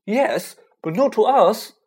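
sharp, exasperated retort. Acted. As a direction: snap it back, fed up. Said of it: "said Ron in exasperation".

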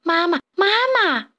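eager tadpoles calling in a high voice; repeated calling. A narrator's reading. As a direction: call out for Mama, voice lifted high. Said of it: "连声大喊".

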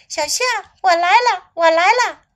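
calling out. A narrator's reading. Snake rushing to rescue little elephant, calling out. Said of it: "蛇叫道".

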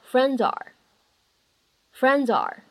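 "friends are" slowly, the z carried into "are"; linked reading. Shown as a demonstration. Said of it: "咱们可以做一个连读". (慢速连读 friends are)

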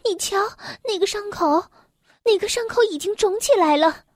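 alarmed exclaiming. A narrator's reading. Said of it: "艾洛蒂嚷嚷着".

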